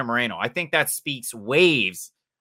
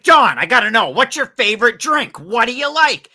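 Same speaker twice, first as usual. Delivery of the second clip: much higher and louder, gushing fan-reporter voice; over-the-top ESPN reporter; 11 semitones higher, 7 dB louder than usual. Retold or imitated. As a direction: squeal the questions like an overexcited pink-shirt reporter. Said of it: "he's like".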